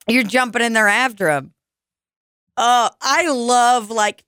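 usual speaking voice

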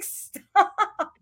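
high-pitched mock-mad outburst; exasperated plea. Woman begging fiancé to quit it, exasperated. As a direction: whine it out in comic exasperation. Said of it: "It makes me so mad".

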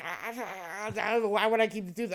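high mock cackle running into the words; hysterical laughing. Daughter on laughing gas to dentist, imitated helpless giggling. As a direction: imitate a girl who can't stop laughing. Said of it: "she just starts hysterical laughing".

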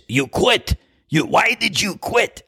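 high, sharp imitation of an angry coach; mock coach berating a quitter; mock scolding. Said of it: "What she's saying is".

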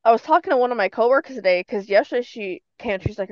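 ordinary talk, nothing marked

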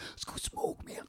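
hushed stoner whisper. Retold or imitated. Stoners behind him whispering an invite. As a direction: whisper it behind his back.

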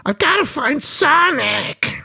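high, slow, squeaky cartoon call; hammed-up cartoon voice, very high and slow; cartoon hero cry. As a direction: stretch it out like a frantic cartoon sidekick. Character line from the film.

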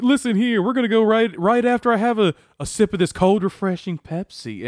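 high, loud movie-character ad plug; mock sales pitch. Movie character cutting to an ad, hammed-up high pitch. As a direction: belt out a hammed-up product plug. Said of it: "He was like".

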